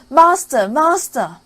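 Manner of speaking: high, loud alarm cry; harp calling loud. Acted. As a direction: raise the alarm shrilly. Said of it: "the harp cried out".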